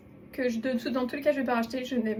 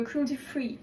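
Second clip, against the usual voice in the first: slow, put-on English accent on cruelty free; 5 dB quieter, slower than usual. Shown as a demonstration. Vlogger jokes about her English accent while naming a label. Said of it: "Notez mon accent anglais".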